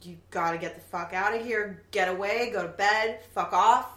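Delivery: building to a loud yell; retold yelling. Ex yelling at her to get away, retold yell. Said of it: "And he just yelled".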